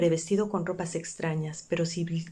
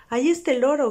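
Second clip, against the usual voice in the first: higher, louder cry of delight; joyful shout; excited shout; 7 semitones higher, 6 dB louder than usual. A narrator's reading. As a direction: call out the parrot with glee. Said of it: "gritó Scrooge".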